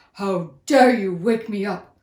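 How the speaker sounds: loud, booming lion fury; angry lion roar. Acted. Woken lion scolding the mouse, loud and furious. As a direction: roar it like a woken king of beasts. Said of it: "the lion roared angrily".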